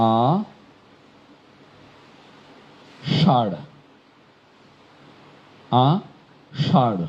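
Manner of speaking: আষাঢ় slowly split, ending in a plain ড় with no breath. Shown as a demonstration. Teacher showing the exception word. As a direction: drop the h at the end of আষাঢ়. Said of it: "এটির উচ্চারণ".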